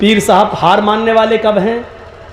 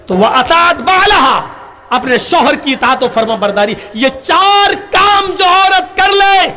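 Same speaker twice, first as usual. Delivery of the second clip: preaching; 6 semitones higher than usual. Acted. Preacher addresses the congregation, full sermon fervour.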